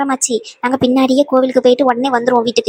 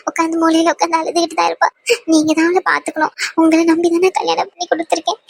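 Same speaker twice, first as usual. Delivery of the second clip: sobs breaking up the phrases; tearful pleading; 3 semitones higher than usual. Acted. Mother entrusting her child to the in-laws, crying. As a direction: beg through tears, voice catching.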